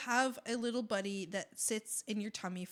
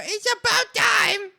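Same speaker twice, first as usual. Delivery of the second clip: loud, high, slower shout; slower, louder exclamation, high and emphatic; 9 semitones higher, 11 dB louder than usual. Real, not acted. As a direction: belt it out, about time. Repeats the phrase much louder.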